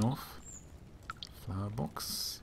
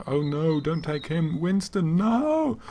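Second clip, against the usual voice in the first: high shout building louder to the final no; shouted plea; 7 semitones higher, 5 dB louder, slower than usual. Retold or imitated. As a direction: cry out as he is taken. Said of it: "shouted something like".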